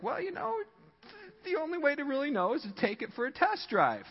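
high-pitched mock salesman voice; car salesman. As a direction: coax a buyer in a high put-on voice.

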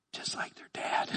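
soft unvoiced whisper, teasing; whispered aside. Real, not acted. Whispering a joke about the boys to the congregation.

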